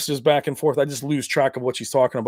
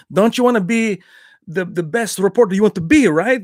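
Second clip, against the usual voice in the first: put-on higher, louder Belgian-accented plea; Van Damme impression; 5 semitones higher, 4 dB louder than usual. Van Damme pressing the reporter, mock accent. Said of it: "he's like".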